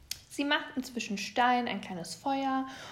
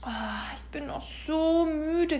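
acted sleepy yawn from a father bear; 6 semitones higher, 3 dB louder than usual. A narrator's reading. Papa Bär woken from winter sleep, yawning. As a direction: groan it out through a yawn. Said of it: "gähnte Papa Bär".

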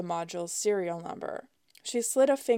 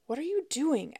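hissed; 7 semitones higher than usual. A narrator's reading. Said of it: "Alex hissed".

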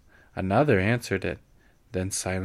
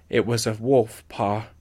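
usual speaking voice

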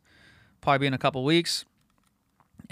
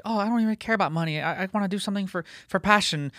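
put-on naive kid; 5 semitones higher than usual. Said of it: "you'll say things like".

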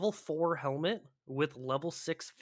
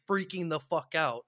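ordinary delivery

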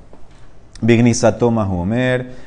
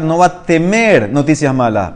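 ordinary talk

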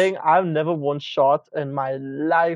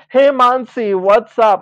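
loud, higher mock-casual hail; over-familiar greeting; 5 semitones higher, 7 dB louder, slower than usual. Student greeting a teacher like a pal, mock chummy. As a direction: call out a chummy greeting, putting it on.

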